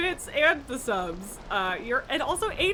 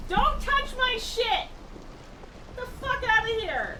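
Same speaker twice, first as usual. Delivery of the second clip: mock angry scold at pet; 9 semitones higher than usual. Real, not acted. Scolding her cat off the desk.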